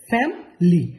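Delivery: ফ্যামিলি as two syllables, "fam-ly"; native pronunciation. Shown as a demonstration. Teacher modelling the native form, ফ্যামিলি as "fam-ly". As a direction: drop the middle vowel in ফ্যামিলি, "fam-ly". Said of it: "দেখেন".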